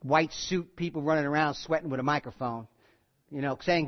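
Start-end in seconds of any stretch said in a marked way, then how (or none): none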